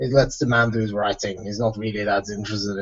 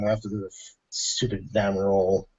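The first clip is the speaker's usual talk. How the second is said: hard to catch; 3 semitones lower, 7 dB quieter than usual. Real, not acted. Player grumbles about a game roll, too soft to catch. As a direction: let it sink away, half lost. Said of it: "I didn't catch some of that".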